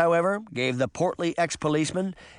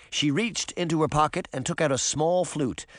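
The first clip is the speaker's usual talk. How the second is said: ordinary delivery, nothing marked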